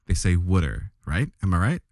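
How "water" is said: put-on "wooder" in a Philadelphia accent, first vowel as in "wood"; Philadelphia pronunciation. Joking about locals, Philadelphia-accented "wooder". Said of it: "how people pronounce in Philadelphia".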